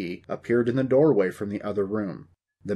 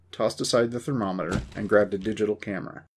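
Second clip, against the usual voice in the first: ordinary delivery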